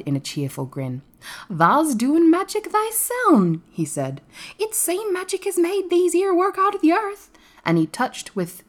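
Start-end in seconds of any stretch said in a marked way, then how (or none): 1.5-3.6 high, loud, slow Yorkshire lad; drawn-out dialect, pitched high; cheerful Dickon. A narrator's reading. Dickon voiced, answering cheerfully. 4.6-7.3 high-pitched Yorkshire country lad; broad dialect in a high voice; Dickon. A narrator's reading.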